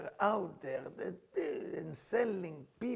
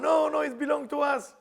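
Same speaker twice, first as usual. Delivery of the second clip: higher, louder objecting shout; mock protest; 8 semitones higher, 7 dB louder than usual. People of Meron claiming the body, loud mock protest. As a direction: object loudly as a rival crowd. Acted quarrel over the burial.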